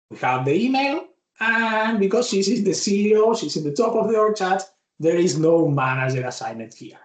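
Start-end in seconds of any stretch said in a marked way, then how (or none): none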